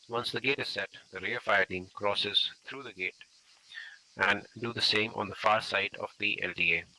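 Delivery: loud voice carried across a road; projecting voice. Real, not acted. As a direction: project to the team on the far side.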